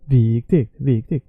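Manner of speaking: VIKTIGT dipping on long VIK, rising on TIGT; down-and-up melody. Shown as a demonstration. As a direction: go down, then up through "viktigt". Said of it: "imagine going down and up".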